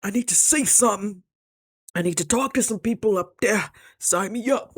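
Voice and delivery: high, excited squeal of eagerness; mock eager high voice; excited sign me up. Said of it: "I'm like".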